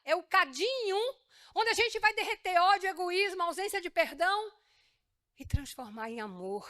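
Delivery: 1 Jesus declaring. Acted. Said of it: "Aí Jesus diz assim".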